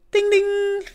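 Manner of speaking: high sung ding ding notification; message alert. Pretend. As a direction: chime like a phone alert.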